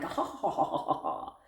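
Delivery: soft breathy ha ha ha; a quiet mock-evil chuckle; demo of her laugh. Shown as a demonstration. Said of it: "my laugh comes off like".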